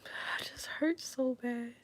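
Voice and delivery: slow, breathy, no voice at all; letting the pain out in a whisper; real whisper. Real, not acted. Whispered after crying.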